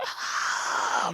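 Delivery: breathy, voiceless attempt at a scream; imitated failed scream. Friend retelling the victim calling for her mom, breathy failed scream. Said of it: "She's calling out for her mom".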